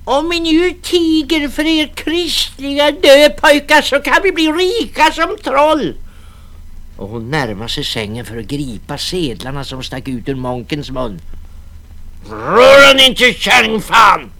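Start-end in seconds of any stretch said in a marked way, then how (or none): 0.0-5.9 high crone voice, scheming; greedy old woman. Acted. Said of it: "Hon sa". 12.3-14.3 loud high slow snarl, Rör punched; angry shout. Acted. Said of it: "skrek rallarna".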